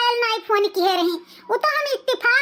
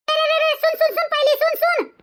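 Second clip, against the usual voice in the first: urgent calling back; 6 semitones higher than usual. Acted. Mother stops daughter hanging up.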